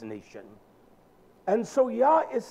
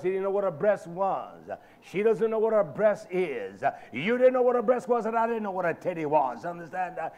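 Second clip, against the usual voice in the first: ordinary talk, nothing marked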